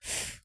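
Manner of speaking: "F" as a long unvoiced "fff", not the letter name; phoneme F alone. Shown as a demonstration. Teacher showing the phoneme of fé, "F" as a sustained "fff". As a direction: blow out the bare sound of "F". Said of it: "eu vou usar o fonema F".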